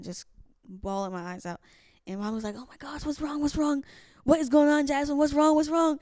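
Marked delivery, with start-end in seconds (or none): 2.6-6.0 imitating a worried mother, higher and louder; retold motherly alarm, pitched up; alarmed mom. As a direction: fire off panicked questions, building louder. Imitates mom alarmed at her bawling.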